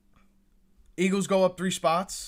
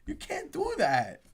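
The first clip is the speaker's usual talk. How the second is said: put-on higher, whinier pouting kid; 11 semitones higher than usual. Fan pouting like a kid over the trade. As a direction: whine it like a small child. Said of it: "a little kid pouting".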